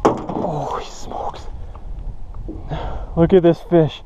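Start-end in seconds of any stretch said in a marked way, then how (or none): 0.0-1.5 slow, soft whispered amazement; awed whisper. Real, not acted. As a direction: draw it out under your breath. Marvelling at the catch.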